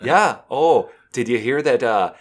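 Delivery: gossipy Minnesota accent; folksy, hesitant Minnesota small talk. Shown as a demonstration. Neighbor gossiping about Georgie's hot dish, Minnesota accent. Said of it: "do a Minnesota accent".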